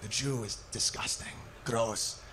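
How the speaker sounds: low, soft, slow sneering voice; deep hushed mock of a hateful guy; mock bigot. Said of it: "They're like".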